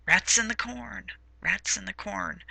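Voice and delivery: a hint of a figure, softly intoned, dying away; Mother Abigail. Teacher quoting a novel's recurring saying, lightly voiced, softly intoned. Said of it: "Mother Abigail says".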